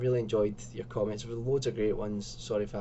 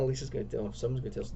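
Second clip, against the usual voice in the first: ordinary talk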